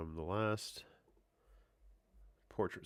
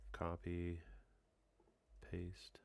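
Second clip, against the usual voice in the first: whispering; 9 dB quieter, much slower than usual. Real, not acted. Quiet aside while editing.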